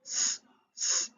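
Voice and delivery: a long voiceless s, hissed twice; hissed s sound. Shown as a demonstration. Teacher hissing the s sound to show alliteration. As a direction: hiss the s for the class. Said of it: "you can see the s".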